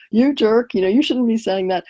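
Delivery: lightly acted annoyed outburst; pretend anger. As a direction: play at telling off your big brother. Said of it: "let's pretend I'm getting angry with my brother".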